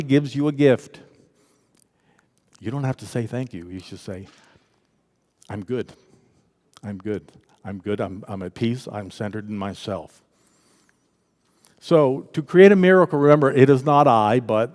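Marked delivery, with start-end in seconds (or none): 5.5-10.2 soft, low, settled calm; hushed and serene; peaceful, centered. Acted. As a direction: say it quietly, wholly at peace. Models being at peace.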